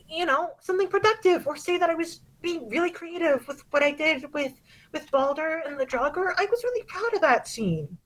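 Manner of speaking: put-on high, hurt girl's voice close to tears; a wounded kid pleading with Mom; lightly voiced daughter, crying out at first; upset teenage daughter. Teen girl upset with her mom.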